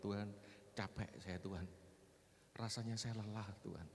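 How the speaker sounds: weary, softened plea without sobs; retold tearful prayer. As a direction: re-enact tired praying, quietly. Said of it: "saya sama istri saya berdoa, nangis". (menirukan doa lirih penuh lelah)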